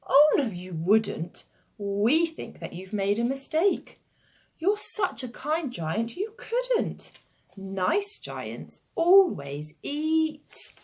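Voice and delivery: put-on sing-song children contradicting the giant; protesting children. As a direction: protest coaxingly, stretching into a slow build-up.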